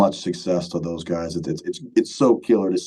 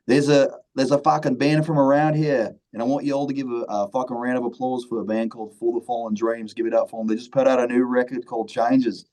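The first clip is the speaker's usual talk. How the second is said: put-on Australian accent; 5 semitones higher than usual. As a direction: do a bad Australian MC. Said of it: "I'm gonna do a terrible Australian accent".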